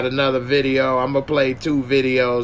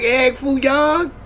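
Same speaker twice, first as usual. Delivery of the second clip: mock teasing; 8 semitones higher than usual. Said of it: "I'm like".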